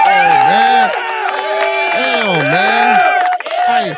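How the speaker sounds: loud, high outburst; loud exclamation. Real, not acted. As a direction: blurt it out in disbelief. Reacting to his empty card.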